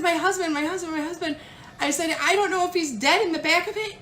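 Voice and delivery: high, pleading, near tears; distraught repetition. Retold or imitated. Wife pleading with Stacy about her missing husband, distraught. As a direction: keep repeating it in rising panic. Retelling her own crying fit.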